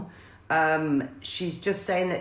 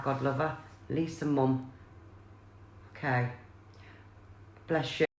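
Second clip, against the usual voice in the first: getting emotional; 5 semitones lower, 8 dB quieter than usual. Real, not acted. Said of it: "She's making me feel emotional".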